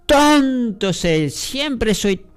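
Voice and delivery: high, very slow mock self-pity; hammed-up whiny self-blame, pitched high; self-pitying moan. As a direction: drag it out like a sorry self-insult. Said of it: "esa palabrita así decimos".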